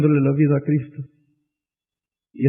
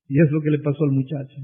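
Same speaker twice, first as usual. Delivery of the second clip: usual speaking voice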